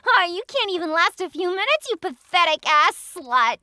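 high, loud mocking taunt; mocking laugh. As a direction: laugh at him with contempt. Mocking his quick finish.

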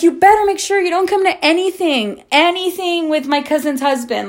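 high, emphatic warning; retold indignant threat; stern warning.

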